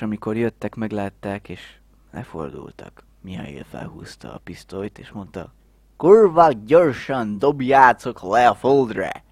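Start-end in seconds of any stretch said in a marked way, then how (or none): 6.0-9.2 shouting. Retold or imitated. Mihály robbing them at gunpoint.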